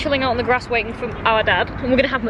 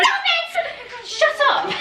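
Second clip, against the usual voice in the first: laughing yell; 13 semitones higher, much slower than usual. Real, not acted. Yelling at the film, laughing.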